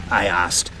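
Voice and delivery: plain reading voice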